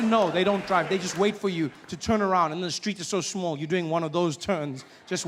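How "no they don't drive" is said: normal talking voice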